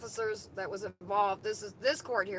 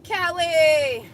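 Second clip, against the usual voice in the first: loud, high call out the window; 7 semitones higher, 11 dB louder, slower than usual. Real, not acted. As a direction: shout a name across the street. Calling to someone outside car.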